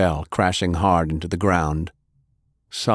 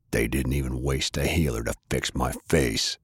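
low, bitter brother's taunt; a lower, resentful character voice; Maddie's voice; 6 semitones lower, 5 dB quieter than usual. A narrator's reading.

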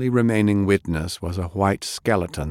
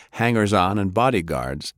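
ordinary delivery, nothing marked

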